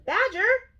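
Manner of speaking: high, loud urgent yell. A narrator's reading. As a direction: call out sharply to a friend. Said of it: "yelled Rabbit".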